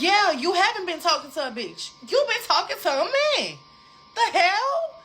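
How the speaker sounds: high-pitched, heated comeback; indignant accusation. Retold or imitated. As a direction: re-enact calling him out, voice climbing. Acts out accusing the boyfriend.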